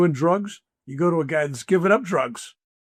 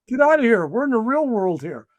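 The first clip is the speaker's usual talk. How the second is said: dismissive outburst; 9 semitones higher, 6 dB louder than usual. Retold or imitated. Voicing a listener's dismissive retort.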